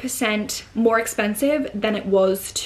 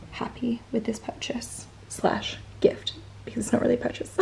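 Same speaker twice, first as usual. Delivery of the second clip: whispered aside; 9 dB quieter than usual. Real, not acted. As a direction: whisper purchase slash gift like a little secret. Sly aside about the gift.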